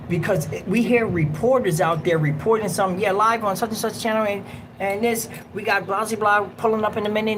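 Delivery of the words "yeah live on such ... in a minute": higher-pitched, lightly voiced live reporter; TV reporter imitation. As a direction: rattle off a mock live report. Mimics TV reporters outside.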